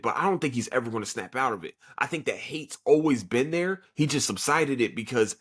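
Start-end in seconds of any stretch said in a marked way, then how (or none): none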